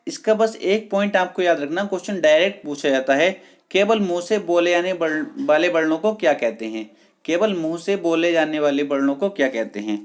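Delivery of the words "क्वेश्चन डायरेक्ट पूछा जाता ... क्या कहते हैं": ordinary talk, nothing marked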